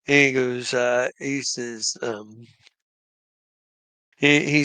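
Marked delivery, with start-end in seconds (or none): none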